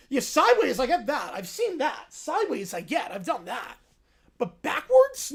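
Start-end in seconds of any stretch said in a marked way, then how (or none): 4.4-5.3 surprised. Real, not acted. Incredulous question.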